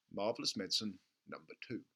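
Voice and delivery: ordinary delivery, nothing marked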